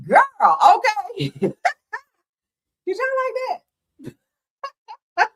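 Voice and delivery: high, drawn-out words squeezed through laughter; barely gets it out while crying laughing; laughing to tears. Real, not acted. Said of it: "Oh, I'm crying".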